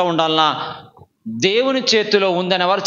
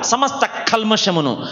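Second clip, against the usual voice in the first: normal talking voice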